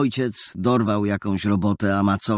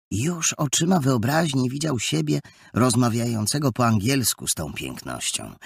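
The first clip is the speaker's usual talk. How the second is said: plain reading voice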